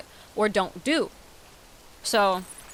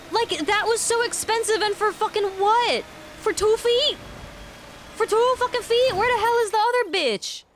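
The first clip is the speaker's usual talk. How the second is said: high, indignant disbelief at the cost; indignant outburst; 11 semitones higher than usual. Real, not acted. Gamer outraged at a pricey two-foot item, high and indignant. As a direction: squeak out the outrage.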